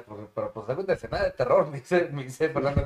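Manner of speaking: ordinary talk, nothing marked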